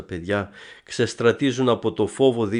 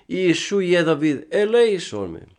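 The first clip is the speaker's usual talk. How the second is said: higher, louder, insistent plea; the blind man cries out louder despite the crowd; crying out even louder; 7 semitones higher, 5 dB louder than usual. Retold or imitated. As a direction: shout it over the crowd trying to hush him. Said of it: "περισσότερο ακόμα φώναζε".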